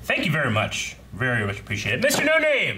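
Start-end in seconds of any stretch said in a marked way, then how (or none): 2.0-2.8 high, far announcer call of the sender; called-out name. Acted. Host announcing the anonymous sender, called out high.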